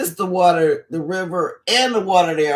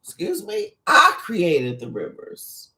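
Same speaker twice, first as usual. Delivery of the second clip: mock indignation; 2 semitones higher, slower than usual. Creator answering Pharaoh's claim about the river, acted indignation. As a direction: act affronted, then slowly lay down the claim.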